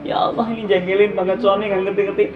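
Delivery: scene: preacher mimicking a wife complaining to God, whiny tears; mock tearful complaint. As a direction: act out a wife crying over an annoying husband.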